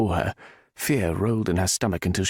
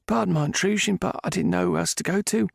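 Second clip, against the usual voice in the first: put-on higher older maid voice, urgent; distraught maid; 10 semitones higher than usual. A narrator's reading. Maid telling Gray about the Viscount, distraught. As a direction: rush the story out anxiously.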